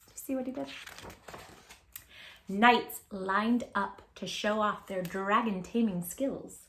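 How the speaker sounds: ordinary delivery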